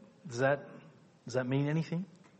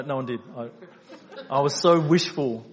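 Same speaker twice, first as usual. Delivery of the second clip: normal talking voice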